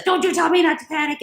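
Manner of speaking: a frightened magician yelling back at another; panicked yell. Acted. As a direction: scream it back at him in terror.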